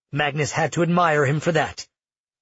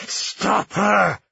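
higher, forceful yell; 6 semitones higher than usual. A narrator's reading. Kian yelling at Magnus to stop Lucia, forceful. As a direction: yell the order, pushing hard. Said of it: "Kian yelled from within Magnus".

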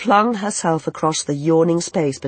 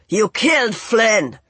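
raised, drawn-out accusing shout; shouted accusation; 6 semitones higher, 4 dB louder, slower than usual. A narrator's reading. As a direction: hurl the accusation back at full voice, slowly. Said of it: "he shouted back".

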